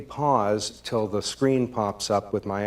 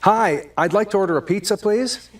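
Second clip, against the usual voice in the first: higher, cheerful and quick; bright and friendly; 7 semitones higher, 4 dB louder than usual. Shown as a demonstration. Caller greeting the pizza parlor, chirpy and upbeat. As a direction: sound delighted to be calling. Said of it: "Here goes".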